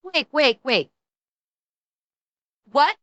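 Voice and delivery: usual speaking voice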